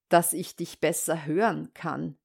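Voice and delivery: low, measured wolf's reply; wolf posing as grandmother. Acted. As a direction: answer low and sly as the wolf. Disguised wolf answers the girl.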